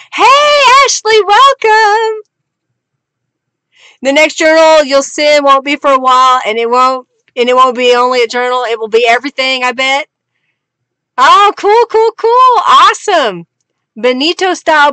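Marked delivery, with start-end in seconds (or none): 0.1-2.2 high, bright call of hello to a newcomer; light up and welcome her in loudly; excited greeting. Real, not acted. Greeting Ashley as she joins the stream, excited and high. 11.2-13.5 high, loud delighted cools; excited. Real, not acted. Thrilled by Raven's promised box of supplies, loud and high.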